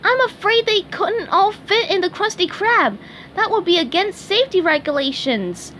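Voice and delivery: high cartoon sponge voice, earnest; eager SpongeBob. A narrator's reading. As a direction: chirp it like a cheery sea sponge. SpongeBob character voice.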